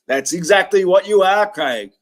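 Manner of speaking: lashing out at Craig; angry. Real, not acted.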